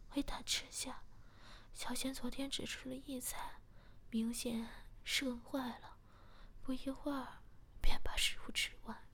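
plain reading voice

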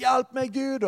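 high, pleading call to God; a mock plea, pitched high; desperate cry for help. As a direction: cry out as if at the end of your rope. Crying out to God in need.

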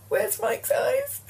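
high slow crying wail; mock wail. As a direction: wail it in fake despair. Wailing in mock despair.

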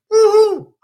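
high, loud celebratory woo-hoo; cheering whoop. Real, not acted. Host cheering viewers to own their desires, loud whoop.

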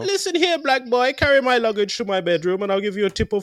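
high, far put-on snooty tourist voice; mock posh European tourist. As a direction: lord it over the porter in a mock posh voice. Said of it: "they're like".

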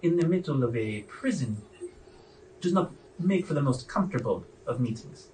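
deliberate warden, drawing out each phrase with pauses; needling prison warden. Acted. Warden exposing her former prisoner before his companions, knowing and needling. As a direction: toy with them as a knowing warden.

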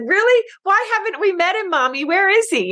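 put-on eager small child asking mommy; young girl amazed, asking her mom about Jesus; childlike surprise. Child self quoted asking mom.

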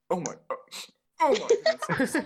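very high, loud mock-shocked oh my goodness; hammed-up teacher reeling from the smell; disgusted teacher. Teacher smells the fart and asks who needs out, high shocked mock voice. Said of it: "He's like".

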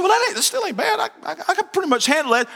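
high-pitched and laughing through it; chuckling mock reassurance; laughing visitor. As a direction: squeak it out amused.

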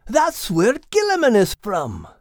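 high, cheery Scottish brogue; lilting Scottish accent, pitched high; Scottish terrier voice. Acted. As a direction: play a proud little Scottish dog. Max voice held.

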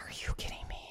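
whispered, half to herself; an incredulous aside under the breath; disbelieving whisper. Real, not acted. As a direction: hiss it in disbelief. Disbelief as dog naps.

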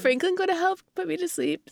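high-pitched imitation of a toddler; small child's voice, asking for bedtime help; Evie, asking. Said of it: "and she's like".

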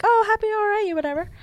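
high, breezy mock-happy tone; mock cheerful acceptance. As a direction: parody the parents shrugging it off. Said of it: "I didn't expect them to be like".

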